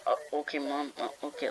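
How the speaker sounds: put-on soft, deflated kid's okay; sulky busted kid. Scolded kid answering mom, soft and sulky. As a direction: mumble it like a scolded child. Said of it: "you know those kids are like".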